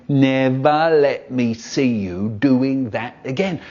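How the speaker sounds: slow, wagging-finger dad impression; mock stern dad. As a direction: drag it out like a telling-off father. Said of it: "just like my dad".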